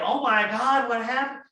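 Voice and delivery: high, alarmed gasp of a question; mock shock. Shown as a demonstration. The wrong way to greet a reported accident, mock shocked. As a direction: play the panicked reaction to avoid. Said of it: "like you're shocked or something".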